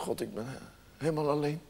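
very soft, low and lonely; tearfully forlorn. Acted. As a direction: say it tiny and hushed, near tears. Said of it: "God daar staat te huilen". (heel zacht, verdrietig en eenzaam)

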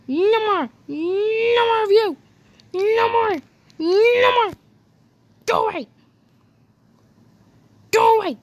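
high, loud mock panic; fending off a ship, high mock yelling; hammed-up shouting. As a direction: shout it away like it's attacking you.

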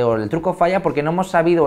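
ordinary talk, nothing marked

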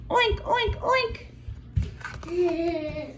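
high, fading oinks; piggy oinks. Pretend. Teacher reading to children, high piggy oinks. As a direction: oink like a little piggy. Pig sound in picture book.

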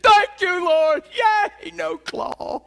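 high, loud whooping celebration; jubilant shout. Retold or imitated. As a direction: shout it like you just escaped surgery. Celebrating avoiding surgery.

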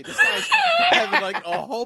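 high giggly words breaking into laughter; cracking up mid-sentence; laughing while talking. Real, not acted. Comic laughing at his own story to his cohost, giggling talk.

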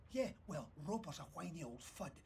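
ordinary delivery

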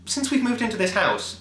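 ordinary delivery

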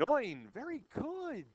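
mock German accent, swooping up on the praise. Teacher asking class for their best German accent, mock German accent. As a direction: put on a playful German accent. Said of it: "in your best German accent".

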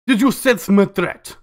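put-on slow, menacing Russian accent. A rival operator taunting Lan, in a slow Russian accent. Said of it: "freaking Russian now".

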